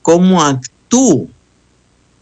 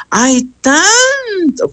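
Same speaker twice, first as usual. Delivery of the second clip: drawn-out, much higher whiny wail; whiny sigh of complaint; 12 semitones higher, much slower than usual. Retold or imitated. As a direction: stretch it out like a fed-up moan. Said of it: "me salió". (lamento quejumbroso, alargado y mucho más agudo)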